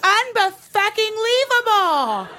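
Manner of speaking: high, slow, drawn-out indignation; outraged disbelief. Retold or imitated. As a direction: stretch it out in mock disbelief.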